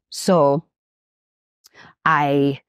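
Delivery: ordinary talk, nothing marked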